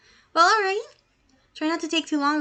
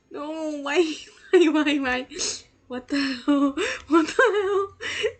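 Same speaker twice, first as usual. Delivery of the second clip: slow, high, half-laughing half-crying disbelief; crying laughter in shock; 3 semitones higher, 4 dB louder, much slower than usual. Real, not acted. Streamer stunned by the game, shocked crying laughter.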